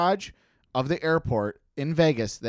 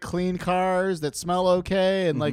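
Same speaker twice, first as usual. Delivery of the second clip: light mock cab driver voice; 6 semitones higher than usual. South Park taxi cab guys.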